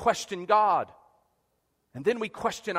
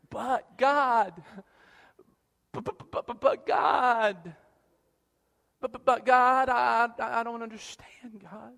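whiny stammering excuse; 3 semitones higher, slower than usual. Retold or imitated. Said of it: "We drop the but".

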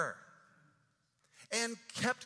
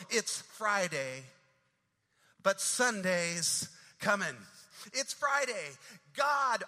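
rising and falling preacher's cadence, building to loud peaks; preaching; 3 dB louder than usual. Retold or imitated. Pastor preaching the Friday sermon to his church, rolling cadence.

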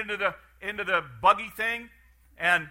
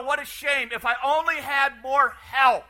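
hammed-up regret; 6 semitones higher, 6 dB louder than usual. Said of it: "You would walk away and go".

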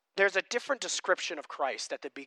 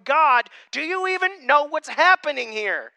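high, loud anguished plea; crying out to God; 11 semitones higher, 13 dB louder than usual. Retold or imitated. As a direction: cry out to God as if he cannot see. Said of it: "screaming out, crying out, going".

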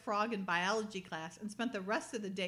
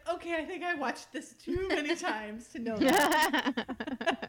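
laughing; 5 semitones higher, 5 dB louder, slower than usual. Retold or imitated. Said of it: "I was like".

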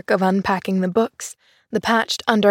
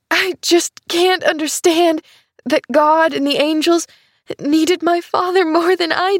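put-on high, tearful child's grief; a hint of crying, voice pitched up; sobbing; 8 semitones higher, 3 dB louder than usual. A narrator's reading. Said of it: "she sobbed".

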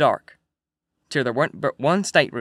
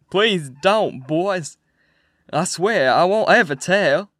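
higher, pleading wail, slowed; wailing plea; 3 semitones higher, 5 dB louder, much slower than usual. A narrator's reading. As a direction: beg the men to spare him. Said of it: "heard a voice wail out and say".

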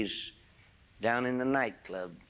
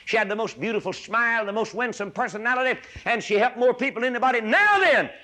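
higher and louder to a cry; climbing preaching shout; 9 semitones higher, 6 dB louder than usual. Real, not acted. Preacher recalling the lady's goodness, rising to a shout. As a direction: build each line up to a shouted peak. Building praise to climax.

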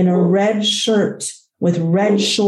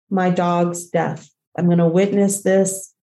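ordinary delivery

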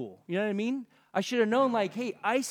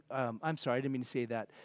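quiet, lower, embarrassed take-back; sheepish apology; 7 semitones lower, 6 dB quieter, faster than usual. Real, not acted. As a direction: softly own up to the slip. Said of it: "there's no more B S".

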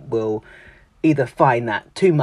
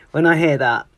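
ordinary talk, nothing marked

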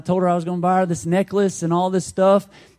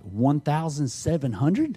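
softer, checking the sum with a rising end; retold incredulous question; 5 dB quieter than usual. Preacher re-enacting his question about the bet, incredulous. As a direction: re-enact a disbelieving double-check, rising on "hundred". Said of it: "And I was like, "What?".

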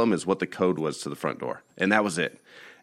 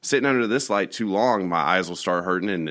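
normal talking voice

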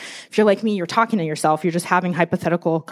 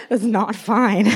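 slow, amused admission through laughter; real laughter breaking around slow words; laughing; much slower than usual. Real, not acted. Laughing at own tech mishap.

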